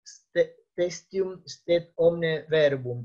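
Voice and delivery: "In ore duorum vel trium testium stet omne verbum" read slowly, word by word, in solemn Latin; slow Latin quotation. Shown as a demonstration. Lecturer reads the famous Latin maxim aloud to students, slow and deliberate. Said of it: "una frase muy conocida es esta".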